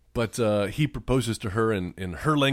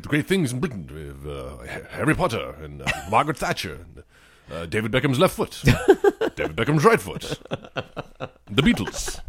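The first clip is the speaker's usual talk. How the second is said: put-on amateur British accent, halting list. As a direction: proudly list treasures in mock British. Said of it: "I have one British accent I can do".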